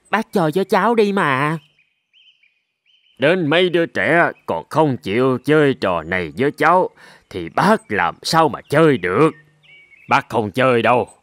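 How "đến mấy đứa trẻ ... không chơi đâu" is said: put-on much lower, elderly sheep voice, amused; an old ram gently brushing off a child. Old sheep turning down the goat kid's challenge, deep and amused.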